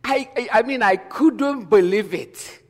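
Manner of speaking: I, I, I tumbling out in amazement; real shock. Real, not acted. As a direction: blurt it out, still stunned. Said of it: "totally shocked".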